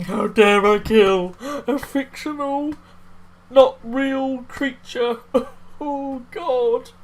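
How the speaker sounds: high, loud, slow sarcastic indignation; mock outrage. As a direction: ham up the offended pearl-clutcher. Mocking the journalist's guilt.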